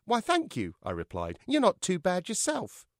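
high, cocky puppet voice; cheeky puppet. A narrator's reading. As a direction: flirt back cheekily. Puppet George replies.

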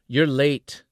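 sharp, loud scolding. Acted. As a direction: scold her for being late. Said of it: "Tacho scolded".

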